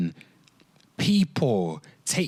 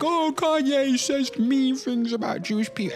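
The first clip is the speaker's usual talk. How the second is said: squeaky, much higher mock-outraged voice; mocking the critics; 12 semitones higher than usual. Mocking people who complained about [name] last year, high whiny voice. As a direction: parody the pearl-clutching crowd with a shrill whine. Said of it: "everyone was like".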